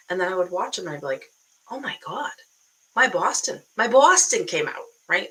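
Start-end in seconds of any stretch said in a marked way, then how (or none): 1.7-4.8 put-on louder Boston accent, slipping toward New York; exaggerated Boston accent. Coach hearing herself on video, hammed-up Boston accent. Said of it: "that's a little bit New York".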